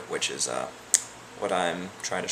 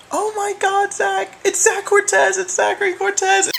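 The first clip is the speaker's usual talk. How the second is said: much higher and louder, a thrilled shriek; excited drunk squeal; 10 dB louder than usual. Retold or imitated. Friend Anuja spots the guy at the party, shrieking excitedly. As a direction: gush over him like a starstruck fan. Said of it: "Anuja comes up to me and is like".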